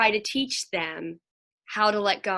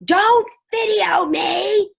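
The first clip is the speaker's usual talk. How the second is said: put-on high, loud, slow child's scream; shrieking toddler tantrum; son screaming; 10 semitones higher, 6 dB louder, slower than usual. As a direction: scream it like a furious four-year-old. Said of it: "my son screaming".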